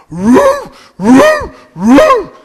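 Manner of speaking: high yelping woof imitation; dog barking. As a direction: bark like a big dog rushing the gate. Great Dane runs barking.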